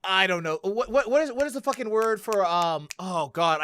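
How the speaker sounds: ordinary talk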